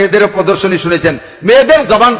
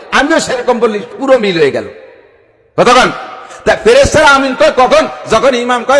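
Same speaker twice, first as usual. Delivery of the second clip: ordinary delivery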